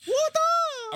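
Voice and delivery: squeaky high what the; startled yelp of disbelief; shocked. Real, not acted. Friend reacting to a swear, shocked high squeak.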